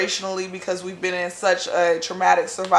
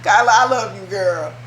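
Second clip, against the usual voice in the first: loving shout-out; 2 semitones higher, 7 dB louder than usual. Real, not acted. Thanking friend who found flights.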